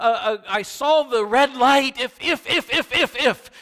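a tormented mind piling up regrets, voice climbing; anguished what-ifs. Retold or imitated. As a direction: spiral into panic, pitch climbing.

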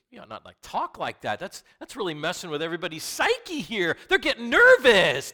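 rising to a high, loud fret; Peter's protest building from plain to loud alarm; worked-up Peter. Retold or imitated. Peter warning Jesus the others are nervous, building alarm.

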